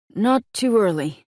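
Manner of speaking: a hint of a sour rival; sour interjection. A narrator's reading. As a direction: butt in, put out and jealous. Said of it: "with a sour scowl at Nolan".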